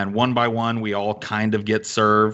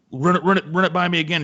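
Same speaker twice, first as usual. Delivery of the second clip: raised-pitch, louder impatient cook imitation; heated spat; 8 semitones higher, 3 dB louder, faster than usual. Cook arguing with waitress over an order, heated. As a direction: act out an irritated cook mid-argument. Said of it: "heated little spats".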